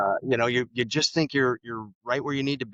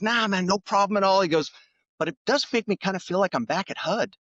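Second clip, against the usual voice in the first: higher, louder easygoing reply, lightly voiced; imitating the secretary; 10 semitones higher, 4 dB louder than usual. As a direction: toss it off like an unbothered cabinet secretary, pitched up. Boss retells secretary's laid-back reply.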